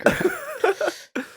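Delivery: very high, very slow mocking voice; silly high drawn-out voice. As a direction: stretch it out in a squeaky goofy voice. Mocking echo of Minecraft.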